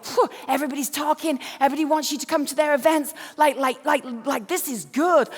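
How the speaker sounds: mock American leadership-guy enthusiasm. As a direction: put on an American accent to hype someone up. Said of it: "there was an American guy there".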